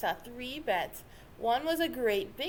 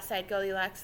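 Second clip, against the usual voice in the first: usual speaking voice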